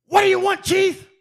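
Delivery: high, loud impatient call-back; a coach fed up with being hailed; impatient reply. Retold or imitated. Saban answers the lineman across the field, loud and impatient.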